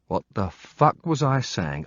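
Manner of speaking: usual speaking voice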